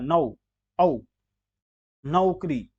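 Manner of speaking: नौ and औ as a gliding "au"; au diphthong. Shown as a demonstration. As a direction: sound out नौ, औ, then नौकरी with "au". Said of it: "जबकि नौकरी होता है".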